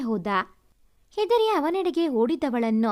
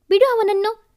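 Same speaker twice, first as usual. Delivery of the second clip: higher and louder, snapping at him; sharp command; 7 semitones higher, 6 dB louder than usual. Acted. Sister steps between and orders her brother, loud sharp command. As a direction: stop him hard, let go now.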